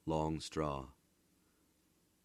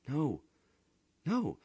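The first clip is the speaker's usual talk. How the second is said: high denial; 10 semitones higher, 4 dB louder than usual. A narrator's reading. Bodini denies wife would hate him.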